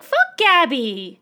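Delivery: drawn-out heckle; exasperated fan yell. Retold or imitated. Fan answering Reiner's wish to save Gabby, mock yelling. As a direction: shout down a hated character. Acted fan outburst against a character.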